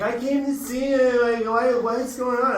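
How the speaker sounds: high mock drunk girl; drunk girlfriend voice. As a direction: imitate the drunk girlfriend whining on the phone. Drunk girlfriend quoted on phone.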